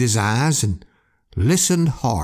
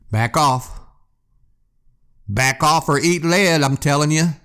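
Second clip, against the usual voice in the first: half-hearted scream; 2 semitones higher than usual. Acted. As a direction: threaten the eyes with a modest yell. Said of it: "screamed Warren".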